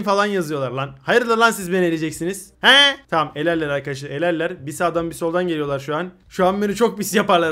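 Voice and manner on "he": indignant huh. Real, not acted. Indignant at chat.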